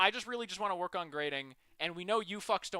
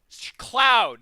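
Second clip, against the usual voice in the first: loud eureka blurt; excited shout; 4 semitones higher, 13 dB louder than usual. Real, not acted. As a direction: shout it as it clicks. Realizing the reference.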